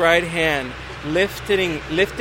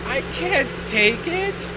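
higher, slow hesitant voice; hesitant guy; 9 semitones higher, much slower than usual. Retold or imitated. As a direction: drag out the refusal. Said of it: "He's like".

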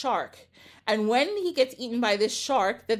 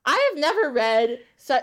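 ordinary delivery

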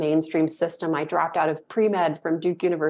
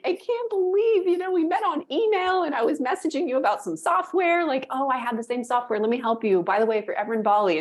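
high, gushing replay of her own delight; breathless high excitement, half acted; excited retelling; 7 semitones higher than usual. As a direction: squeal it like you still can't believe it. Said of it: "I'm like".

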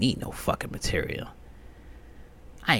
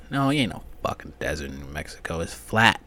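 ordinary talk, nothing marked

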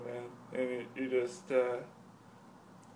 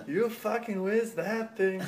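higher, slow marvel at the throw; impressed skit voice; 6 semitones higher, 4 dB louder than usual. Retold or imitated. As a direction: praise the throw, hammed up. Acted skit quote, impressed.

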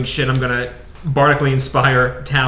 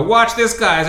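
hammed-up showman; 8 semitones higher, slower than usual. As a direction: show off like a street performer. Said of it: "and I'm gonna go".

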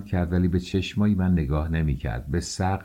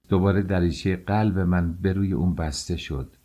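usual speaking voice

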